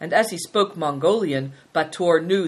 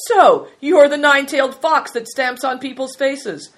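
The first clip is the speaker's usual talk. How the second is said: high, loud, amused exclamation; loud amusement; 8 semitones higher, 7 dB louder than usual. A narrator's reading. Prince to Bator after bursting out laughing, loudly amused. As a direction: announce it with hearty mock accusation. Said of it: "he burst out laughing".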